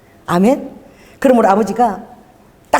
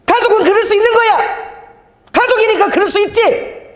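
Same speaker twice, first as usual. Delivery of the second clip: high-pitched reenacted hollering; loud retort; 10 semitones higher than usual. Preacher replays arguing back about family on the phone, loud retort. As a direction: insist at a high, forceful pitch, phrase by phrase. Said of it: "내가 더 큰 소리로 막".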